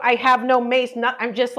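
normal talking voice

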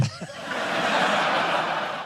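silent wheezing laugh; airy wheeze of laughter; breathless laugh. Real, not acted. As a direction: laugh with no voice, just air. Laughing at the tweet.

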